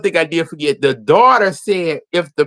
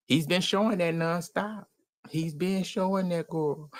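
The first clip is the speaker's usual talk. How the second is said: quiet aside; 10 dB quieter than usual. Retold or imitated. Confiding aside to the wife.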